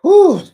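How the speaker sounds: high, loud whew of disbelief. Real, not acted. Host reacting to the beat-up car, loud whew. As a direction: let out a big whew. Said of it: "exclaiming at the car".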